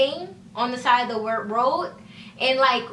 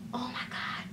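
whispered in a panic, softer; retold frightened whisper; panicked whisper; 13 dB quieter than usual. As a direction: breathe it out in shock. Panicking after hitting house.

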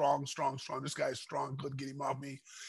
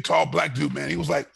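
normal talking voice

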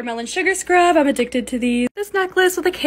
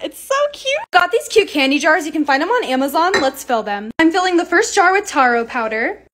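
ordinary talk

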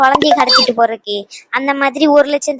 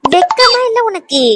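contemptuous scolding; 6 semitones higher than usual. Acted. Scolding the begging villain.

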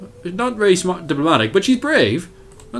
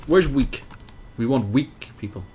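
ordinary delivery

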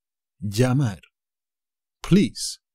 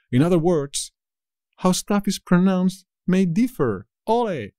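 excited summary; 7 semitones higher than usual. Real, not acted.

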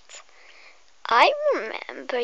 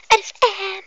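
defiant; 6 semitones higher, 8 dB louder than usual. Acted. Defiant reply.

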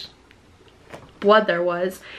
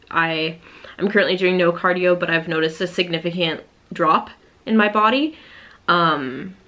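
ordinary delivery

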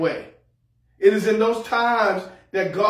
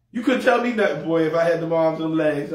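normal talking voice

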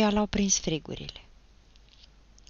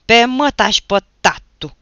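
very loud, raised furious curse; enraged bellow; rabid yell; 6 semitones higher, 14 dB louder than usual. A narrator's reading. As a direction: roar it like a madman. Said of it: "urlă el ca turbat".

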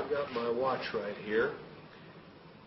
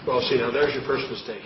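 usual speaking voice

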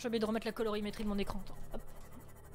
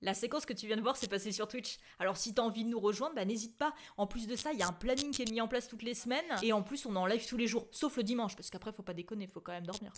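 promo voiceover; 3 semitones lower, 7 dB quieter than usual. Acted. Stream outro promo read.

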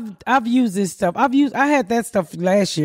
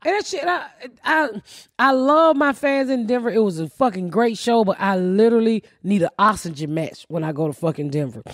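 ordinary talk

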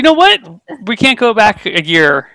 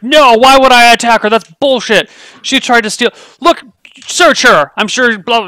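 loud, higher, flustered denial; flustered outrage; 8 semitones higher, 6 dB louder than usual. Acted. Flustered merchant denying the attack to the guards, loud. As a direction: sputter in outrage at the charge. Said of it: "clearly flustered".

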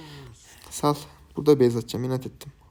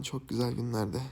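ordinary talk, nothing marked

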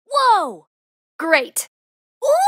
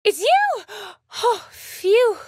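high exclamation into a big phew; startled, then relieved; 6 semitones higher, slower than usual. Acted. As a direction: jump in surprise, then let it out. Game character startled, then relieved.

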